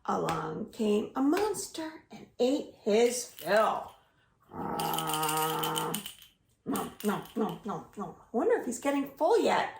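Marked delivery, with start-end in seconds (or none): 4.5-8.2 munching noises. Pretend. Monster eating cookies.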